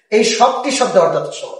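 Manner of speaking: ordinary delivery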